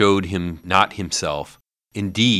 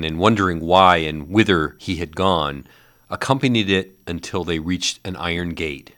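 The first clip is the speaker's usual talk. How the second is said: usual speaking voice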